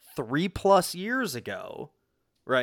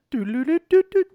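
much higher and louder sung chime notes; mock earbud chime, pitched way up; imitated chime tune; 12 semitones higher, 7 dB louder than usual. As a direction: belt the device beep like a little tune. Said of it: "press and I hold it again".

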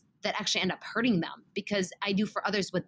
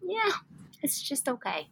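offhand shrug; 7 semitones higher than usual. Acted. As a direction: brush it off as no big deal. Voicing indifference to minor stuff.

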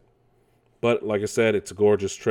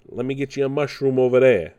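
higher New York wise-guy voice; Brooklyn accent; 4 semitones higher, 4 dB louder than usual. Retold or imitated. Mocking the Mario voice, Brooklyn accent. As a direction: offer it like a Brooklyn street guy. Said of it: "Brooklyn type accent".

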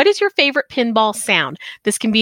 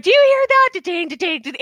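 much higher, eager call across the house; bouncing giddy mimic; excited husband; 9 semitones higher than usual. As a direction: call out thrilled at the dings. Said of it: "he's like".

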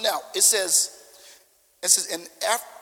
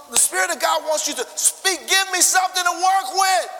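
shouted, higher plea to the crowd; urging shout; 7 semitones higher, 6 dB louder than usual. Real, not acted. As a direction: push them to speak up. Pastor urging congregation to speak.

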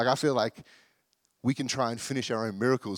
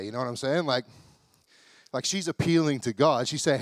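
ordinary talk, nothing marked

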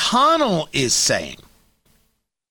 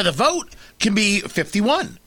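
ordinary delivery

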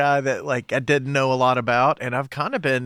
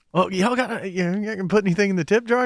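mock tip plea; 7 semitones higher than usual. Musician heckles tough crowd for tips.

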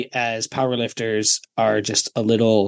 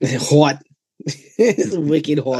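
laughing, exaggerated Boston "hawt"; mock Boston accent; 3 semitones higher, much slower than usual. Riffing on the nickname's spelling, laughing mock Boston accent. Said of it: "Like H A W T?".

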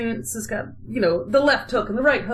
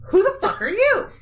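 higher, incredulous demand; indignant challenge; 9 semitones higher than usual. Retold or imitated. Austria dismissing a stranger among royalty, indignant. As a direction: swoop up high in affronted disbelief.

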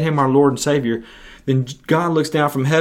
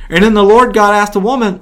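normal talking voice

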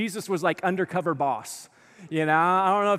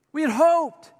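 mournful; 8 semitones higher, 4 dB louder, slower than usual. Retold or imitated. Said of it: "listen to their heart".

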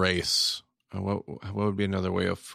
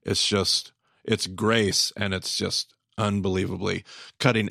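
ordinary talk, nothing marked

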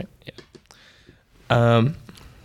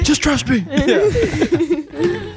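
hammy stage actor; much slower than usual. Acted. Player voices captain in scene.

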